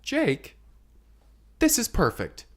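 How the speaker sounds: high, proud praising wife; high-pitched approving wife. Acted. Margaret voiced praising him.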